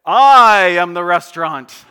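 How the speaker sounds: very loud, drawn-out mock declaration; hammed-up grand announcement at full volume; booming proclamation. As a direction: proclaim it grandly, stretching the first word. Mock grand self-declaration to listeners.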